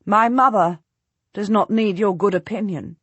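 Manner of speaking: ordinary delivery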